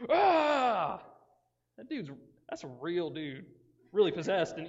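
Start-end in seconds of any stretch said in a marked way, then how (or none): 0.1-1.0 high, loud yelp of pain; pained cry. Acted. Preacher acts out the possessed man's cut, pained yelp. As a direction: yelp as the rock cuts. Acting out cutting with rock.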